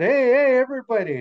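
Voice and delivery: loud, high call to the crowd; high-pitched storybook shout. Acted. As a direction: holler for everybody's attention. Beaver calls everyone to listen.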